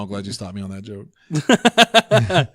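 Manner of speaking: ordinary delivery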